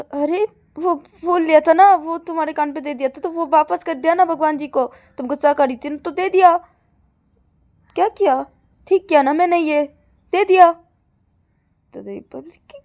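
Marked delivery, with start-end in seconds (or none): 0.0-10.7 high, raised self-justification; defensive protest. Acted. Girl explaining she gave the flower back, defensive protest. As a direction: defend yourself, getting louder.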